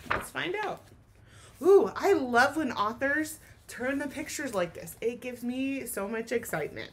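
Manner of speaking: usual speaking voice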